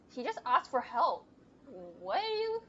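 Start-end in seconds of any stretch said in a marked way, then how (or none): 2.0-2.6 incredulous question. Real, not acted. Player reacting to a suspicious stranger.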